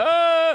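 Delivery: high, loud mock scream; victim's scream. Comic as the captive woman in the pit, high mock scream. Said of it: "And she's going".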